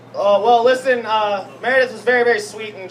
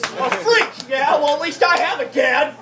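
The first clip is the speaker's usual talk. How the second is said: put-on taunting kid; 6 semitones higher, slower than usual. Kid retorting to Jesus.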